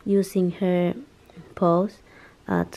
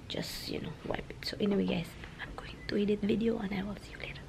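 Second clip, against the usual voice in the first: whispering; 12 dB quieter, faster than usual. Real, not acted. Whispering while someone sleeps.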